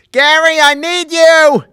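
high, loud, slow needy scream; Richard Simmons screaming. Acted. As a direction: scream it out like a desperate plea. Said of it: "I could just do him screaming Gary".

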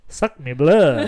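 playful put-on French voice; slow hammy French accent; mock French accent. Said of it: "I just wanted to be like".

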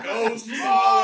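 high, loud, slow command; shouted order. Acted. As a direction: bark it down the lab. Acted boss order.

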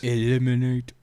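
a hint of monster. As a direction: say it as a playful monster. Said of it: "Monster voice".

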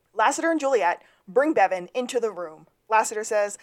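ordinary talk, nothing marked

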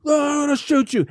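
cop yelling. Retold or imitated. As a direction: yell it at the intruder. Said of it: "they literally bust through".

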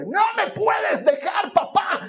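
put-on high shouted whine of a small child; little girl voice. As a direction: cry out like a clingy toddler. Small daughter voiced in story.

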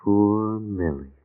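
slow, drawn-out lament; sorrowful. Acted. Husband just told his wife is dead, slowly mournful. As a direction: sigh the words out slowly in grief.

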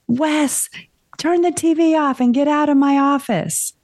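high, loud yell down the hallway; retold yell at son. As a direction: call out to her son like she did then. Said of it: "And so I yelled".

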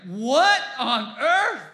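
high, loud, slow astonishment; a churchgoer stunned by an angel in the sky; stunned what on earth. Retold or imitated. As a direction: gasp it out in disbelief. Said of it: "We'd probably be like".

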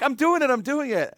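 put-on high, loud thrilled child voice; a kid who has just learned to ride a bike; excited kid. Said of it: "And they're like".

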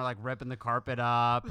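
ordinary delivery

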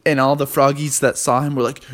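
plain reading voice

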